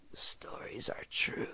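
ghostly, barely voiced whisper; hushed spectral whisper; dry whisper. A narrator's reading. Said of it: "I heard her dry whisper".

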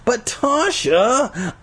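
high, drawn-out whiny plea; pleading. A narrator's reading. Boy begging Tasha to listen, pleading. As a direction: stretch the name out, high and imploring. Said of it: "I started to plead".

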